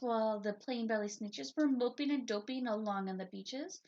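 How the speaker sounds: plain reading voice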